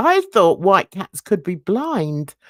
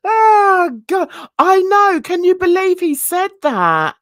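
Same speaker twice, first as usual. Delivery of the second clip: laughing disbelief; 11 semitones higher, 4 dB louder than usual. Real, not acted. Laughing disbelief at vet's remark.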